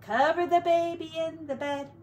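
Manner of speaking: ordinary delivery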